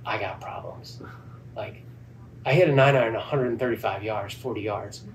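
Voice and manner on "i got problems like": softer whispered worry to himself; whispered dread. Retold or imitated. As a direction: whisper it under your breath, alarmed. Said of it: "And now I'm like".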